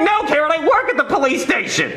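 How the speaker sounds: high-pitched, indignant comeback; exasperated retort. Retold or imitated. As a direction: protest to an accusing wife, voice way up. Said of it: "I'm like".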